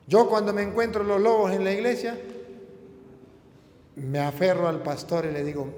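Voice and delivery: ordinary talk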